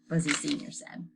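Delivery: plain reading voice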